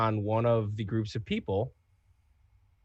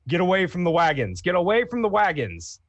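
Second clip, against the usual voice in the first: raised, high-pitched urgent shout; shouted warning; 6 semitones higher, 4 dB louder than usual. Acted. Renmore warning civilians near the wagons, shouting. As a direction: call out to warn people off. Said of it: "turn to them and shout in".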